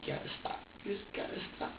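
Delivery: put-on hushed, breathy pleading to the baby; quiet plea to baby. Father retelling his hushed plea to the crying baby, hushed. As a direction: softly beg him to stop. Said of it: "I was like, I was like".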